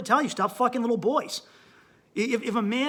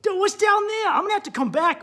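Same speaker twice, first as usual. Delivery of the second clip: much higher, louder cries of discovery; amazed exploring shout; 12 semitones higher, 6 dB louder than usual. Retold or imitated. Lifting a fold, he shouts to himself in amazement. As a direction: yell it like an explorer finding a cave. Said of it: "you go".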